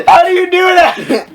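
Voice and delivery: cracking up in disbelief; laughing. Real, not acted. Baffled by the fic line, laughing hard. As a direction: laugh hard through the question.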